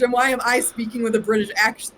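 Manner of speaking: ordinary delivery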